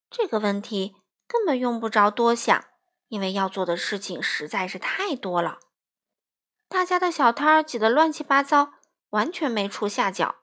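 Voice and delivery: plain reading voice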